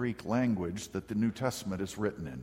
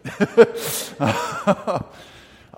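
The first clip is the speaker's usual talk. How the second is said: real laugh; 7 semitones higher, 11 dB louder than usual. Real, not acted. Punchline of C S Lewis story.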